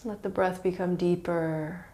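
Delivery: calm, unhurried yoga nidra guidance with long pauses; guided meditation. Real, not acted. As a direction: ease them into relaxation, letting each phrase settle.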